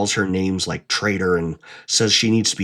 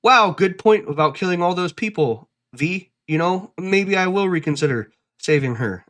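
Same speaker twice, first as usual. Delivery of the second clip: higher, sarcastic mock conversion; mock Myers; 6 semitones higher than usual. Mocking Myers reconsidering for V, higher and sarcastic. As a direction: overplay a fake change of heart. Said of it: "she's not gonna be like".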